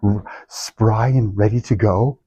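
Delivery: mock-cheerful sarcasm, low and slow; sarcastic. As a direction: say it deadpan, meaning the opposite. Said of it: "How do you feel first thing".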